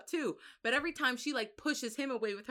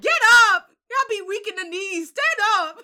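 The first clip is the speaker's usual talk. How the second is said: high, loud scolding yell; exasperated shouting; mock yell; 9 semitones higher, 13 dB louder than usual. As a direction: chew him out to get up. Yelling at Tamlin.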